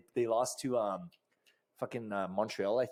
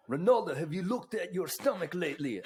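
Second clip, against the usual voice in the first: badly faked Portuguese accent, higher pitch; 6 semitones higher than usual. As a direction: put on a thick Portuguese accent that slips toward Russian. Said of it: "I'm doing an accent, a Portugal accent like that".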